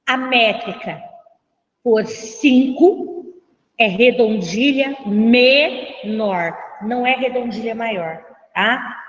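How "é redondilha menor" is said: MENOR drawn out slowly, split as me... nor; slow teacher's correction, pausing inside "menor"; emphatic correction. Shown as a demonstration. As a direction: stretch "menor" into two separate beats. Teacher drills the corrected term.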